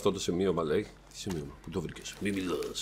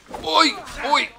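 high startled yelp. Real, not acted. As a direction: cry out when struck. Hit in a fight.

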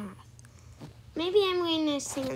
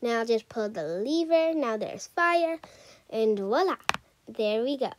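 normal talking voice

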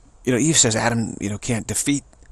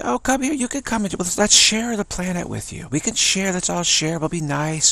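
much higher, gushing mock welcome; naive liberal; 7 semitones higher than usual. Liberals welcoming the dinosaurs, gushing high voice. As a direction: play a gullible host, syrupy and eager.

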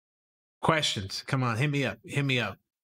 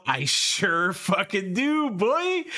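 excited; 6 semitones higher, 3 dB louder, slower than usual. Real, not acted. Excited about a favorite comedian.

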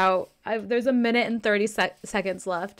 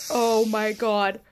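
ordinary delivery

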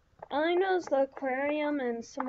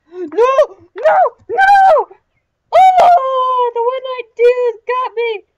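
very high, full-volume screaming in dismay; panicked scream; 10 semitones higher, 19 dB louder than usual. Real, not acted. Gamer killed by the one-eyed dude, screaming in dismay. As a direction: scream it out as you get caught. Player gets killed in the game.

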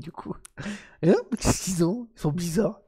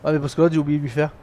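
normal talking voice

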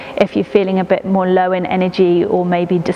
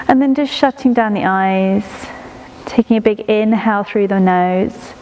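guided meditation. Acted. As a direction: ease the listener into stillness, breath by breath. Leads a prenatal body-scan meditation.

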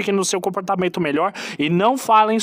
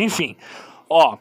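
ordinary talk

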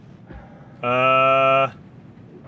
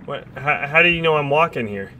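normal talking voice

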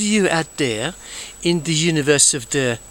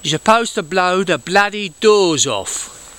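Michael Caine impression; 3 semitones higher, slower than usual. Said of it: "See, I'm very good".

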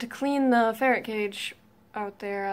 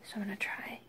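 late-night hushed whisper; 8 dB quieter than usual. Real, not acted. Middle of night on couch.

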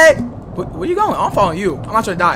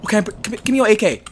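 normal talking voice

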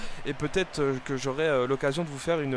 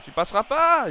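higher and louder, yelling at the attacker; defiant shout; 11 semitones higher, 8 dB louder than usual. Real, not acted. Gamer yelling at the opposing striker, defiant shout. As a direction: shout it at the striker, high and loud.

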